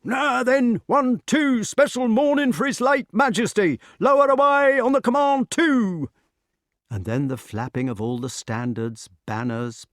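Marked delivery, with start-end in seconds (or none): 0.0-6.1 high, loud sergeant's command; crying out orders. A narrator's reading. Sergeant orders the banners lowered, crying out. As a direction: bellow it high like a drill call. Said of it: "could hear a voice crying out".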